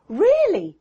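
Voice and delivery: put-on high child's voice for Mary. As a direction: voice the little girl, high and curious.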